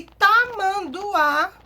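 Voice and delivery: tamanduá slow, high and loud, syllable by syllable; stress on final Á. Shown as a demonstration. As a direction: spell out tamanduá slowly, landing on the last á. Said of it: "a sílaba tônica é o A".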